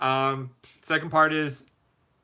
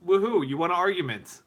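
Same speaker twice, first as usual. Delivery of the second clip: higher-pitched, sarcastic cheer; mock celebration; 5 semitones higher than usual. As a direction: cheer a pointless victory sarcastically. Mocking a pointless argument win.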